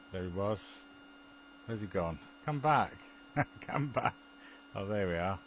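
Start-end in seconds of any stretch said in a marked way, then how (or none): none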